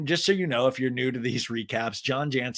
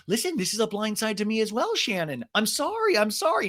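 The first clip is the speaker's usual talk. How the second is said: mock Tamra; 7 semitones higher than usual. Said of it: "Tamra's like".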